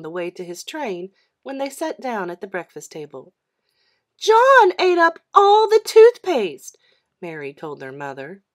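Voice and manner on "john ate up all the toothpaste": high, loud, slow tattle; little girl telling tales, high and loud; tattling little sister. A narrator's reading. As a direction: tell on him to Mum, high and loud. Mary tattles to mother.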